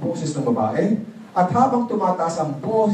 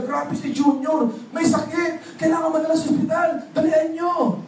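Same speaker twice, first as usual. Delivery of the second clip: mock panic, very high and hurried; high, frantic, hammed-up alarm at a sick child; panicked family; 12 semitones higher than usual. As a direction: play the whole family in a frantic flap, shouting for help.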